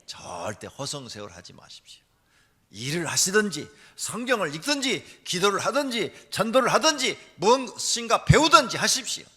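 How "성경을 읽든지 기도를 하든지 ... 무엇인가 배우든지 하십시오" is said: usual speaking voice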